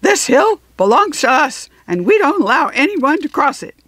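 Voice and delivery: high, loud rough character voice; rough guard voice. A narrator's reading. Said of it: "the same voice said".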